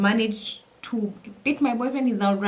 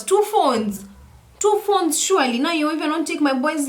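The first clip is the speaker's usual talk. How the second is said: indignant; 6 semitones higher than usual. Retold or imitated. Outraged at thieves.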